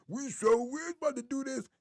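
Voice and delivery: lightly voiced hyped friend; high skit voice. Third of four voices he did in one take, eager. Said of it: "me doing the voices".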